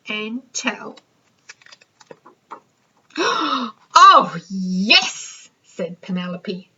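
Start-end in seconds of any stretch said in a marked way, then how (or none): 3.1-3.7 sharp delighted gasp; excited gasp. Acted. Penelope gasping at a find, sharp gasp. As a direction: gasp as if spotting treasure.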